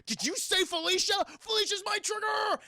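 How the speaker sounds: high, hammed-up outraged cry; outraged villain. Morbius hearing his love Felicia's name, outraged. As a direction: shriek it like a triggered cartoon villain.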